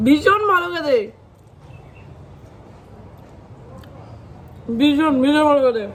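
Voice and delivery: high, loud rave over food; real, ringing enthusiasm; delighted praise. Real, not acted. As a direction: gush emphatically about the taste. Praising the sweet just tasted.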